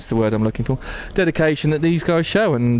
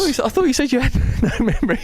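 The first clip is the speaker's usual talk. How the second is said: laughing; 10 semitones higher, faster than usual. Real, not acted. Teasing laugh mid-sentence.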